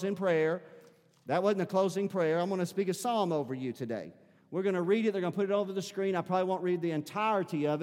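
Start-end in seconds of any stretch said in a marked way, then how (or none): none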